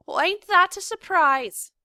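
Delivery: high, loud sarcastic jab; mock her sourly. A narrator's reading. Cook sarcastic.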